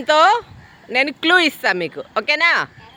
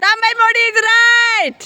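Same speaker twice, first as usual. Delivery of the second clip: much higher, louder and slow, drawn-out; excited announcement; 9 semitones higher, 4 dB louder, much slower than usual. Real, not acted. Teacher announcing the winner to the class, excited and slow. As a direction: draw out the win like a game-show host.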